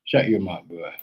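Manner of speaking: ordinary talk, nothing marked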